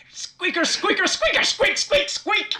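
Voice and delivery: high squeaky rat chatter; rat squeaks. Acted. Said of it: "like, rat squeaks".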